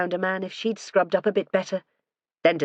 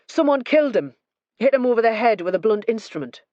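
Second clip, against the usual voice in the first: higher, louder and forthright; blunt; 5 semitones higher, 4 dB louder than usual. A narrator's reading. Detective bluntly telling the couple of the murder, higher and louder. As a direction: say it flatly to shock.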